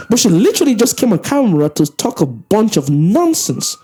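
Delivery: ordinary talk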